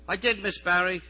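high, loud obedient reply; dutiful boy. Acted. Messenger boy answering supervisor's challenge, dutiful. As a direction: answer up like a scolded schoolboy.

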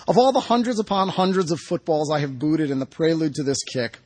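ordinary delivery

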